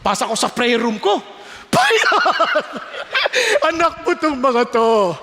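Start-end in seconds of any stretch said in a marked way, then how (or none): 1.7-3.7 a big high laugh that falls away; laughing in prayer. Real, not acted. Laughs while praying. 3.7-5.2 laughing. Real, not acted. Prayer said through laughter.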